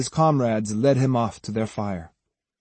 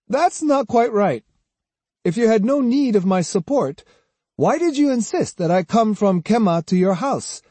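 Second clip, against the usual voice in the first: high-pitched, challenging character voice; Kojiro retorting; 10 semitones higher, 3 dB louder than usual. A narrator's reading. Swordsman asking his hosts why they invited him, challenging. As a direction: press the questions home.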